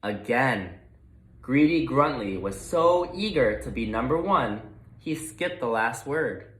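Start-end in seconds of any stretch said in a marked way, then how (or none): none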